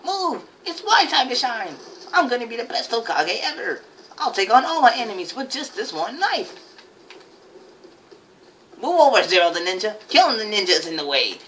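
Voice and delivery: high, hammed-up heroic boast; put-on ninja voice. Gamer playing Zero the Ninja, put-on ninja voice. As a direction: proclaim it as an over-the-top young ninja. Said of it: "Can't do that voice no more".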